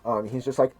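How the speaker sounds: normal talking voice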